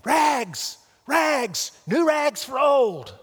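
high, loud vendor cry, slowly stretched; rag man's cry. A narrator's reading. Rag man crying his wares while sobbing, loud. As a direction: call the rags out across the street.

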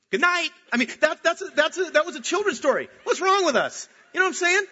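high and loud exclamation; incredulous outburst. Real, not acted. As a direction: exclaim in disbelief at the grim ending. Preacher's incredulous outburst at story.

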